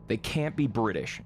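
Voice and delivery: ordinary talk, nothing marked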